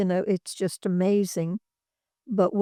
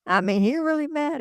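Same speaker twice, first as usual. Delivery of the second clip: ordinary talk, nothing marked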